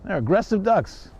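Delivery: normal talking voice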